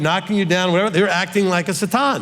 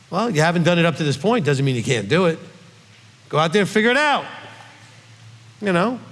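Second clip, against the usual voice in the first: usual speaking voice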